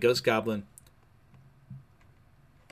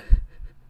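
short, loud burst of laughter; real laugh bursting out; amused laugh; 10 dB louder than usual. Real, not acted. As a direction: break into a quick loud laugh. Laughs while recalling the comic run.

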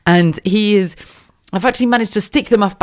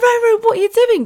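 much higher, playful telling off; mock scolding mum. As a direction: call the toddler out in a high mum voice.

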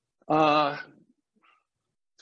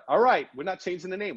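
exasperated giving in; 7 semitones higher than usual. Retold or imitated. Retells giving in to his wife.

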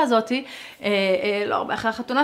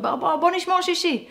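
eager coaxing; 10 semitones higher than usual. Retold or imitated. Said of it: "אמרתי".

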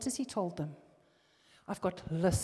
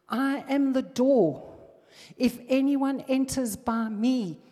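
higher, louder, slowed for emphasis; full-force preaching; 5 semitones higher, 7 dB louder than usual. Acted. Preacher proclaiming Jesus' I am statements, loud and rising. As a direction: hammer each claim home.

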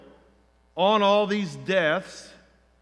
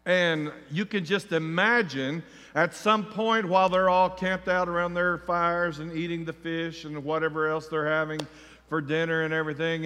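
ordinary talk

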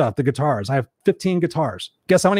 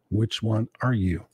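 quieter, slower repeat; 6 semitones lower, 5 dB quieter, slower than usual. Shown as a demonstration. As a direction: ask it again, low and hushed. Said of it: "repeated question".

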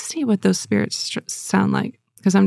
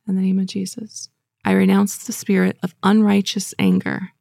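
ordinary talk, nothing marked

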